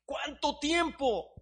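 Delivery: high, slow pleading teacup cry. Acted. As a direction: plead for it to end. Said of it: "la taza gritaba".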